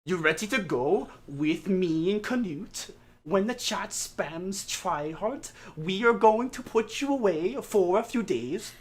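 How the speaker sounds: a hint of a stern nurse taking him away; lightly voiced nurses. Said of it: "walk up right to me and go".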